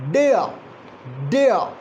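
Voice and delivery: "Dare" as "deh-uh", silent r. Shown as a demonstration. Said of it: "There is no ra".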